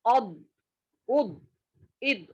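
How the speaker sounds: अब उब इद with the stop held in and echoing inside the mouth; sound kept inside. Shown as a demonstration. Teacher showing the inner echo of the stopped letter. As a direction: close on the letter and let it hum inside. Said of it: "अंदर ही आवाज गूंजेगी".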